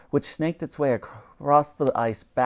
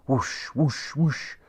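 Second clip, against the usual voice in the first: wind whoosh; much slower than usual. A narrator's reading. Sails rising.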